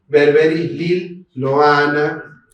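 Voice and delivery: Berberis Lil Loana spelled out slowly, each syllable clear; slow careful naming. Shown as a demonstration. Teacher reading the species name slowly to students, careful pronunciation. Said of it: "La doctora Catani hizo un trabajo".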